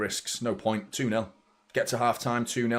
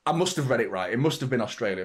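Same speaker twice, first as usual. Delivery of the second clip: ordinary talk, nothing marked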